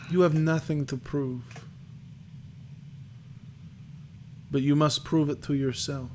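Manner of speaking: lightly voiced old seer, halting; a hint of crone, with long pauses; crackly old crone. Fortune teller reads a young man's future, lightly voiced crone. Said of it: "her voice crackles".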